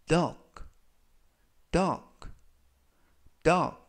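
duck said clearly as /dʌk/; pronunciation model. Shown as a demonstration. Teacher modelling duck for learners to copy. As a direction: say duck crisply, short vowel. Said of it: "라고 발음하시면 되겠고요".

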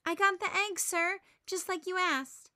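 put-on polite, eager little girl; a hint of a timid schoolgirl; Marcy's voice. A narrator's reading. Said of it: "Marcy says".

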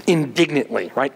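usual speaking voice

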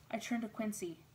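usual speaking voice

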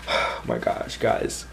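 soft breathy hush of disbelief; whispered awe. Real, not acted. Vlogger awake at one a.m., whispering in awe. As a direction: whisper it, overwhelmed. Late-night overwhelmed hush.

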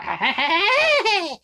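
high, loud clown laugh; Krusty the Clown. Acted. Vlogger imitating a famous clown, high loud laugh. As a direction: burst into a loud clown cackle. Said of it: "Krusty goes".

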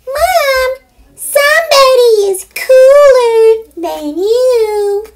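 very high, slow, crying little piglet. Acted. Piglet telling its mother, high and crying. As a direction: wail it like a tiny pig to its mom.

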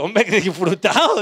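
slow, drawn-out mock disbelief; comic stretched-out incredulity; incredulous echo. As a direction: repeat it back slowly, as if baffled. Echoes the woman's absurd excuse.

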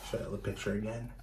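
ordinary delivery, nothing marked

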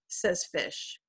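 plain reading voice

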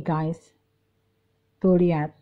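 usual speaking voice

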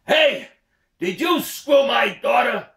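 loud, high, slow yell across mountains; father shouting into the mountains. Acted. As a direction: bellow it out over the valley. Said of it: "he yells out into the mountain range".

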